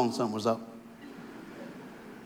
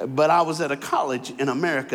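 usual speaking voice

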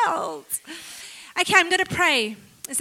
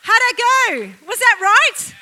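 ordinary talk, nothing marked

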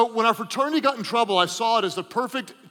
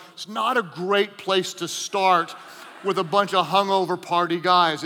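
ordinary delivery

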